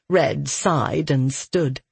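ordinary delivery, nothing marked

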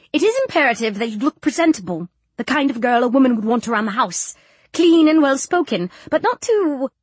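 prim matron's lecture, breaking off; lecturing matron. Acted. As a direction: lay down the rules as a strict matron.

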